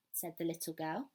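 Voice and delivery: ordinary delivery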